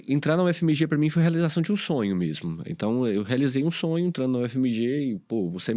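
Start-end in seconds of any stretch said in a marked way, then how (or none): none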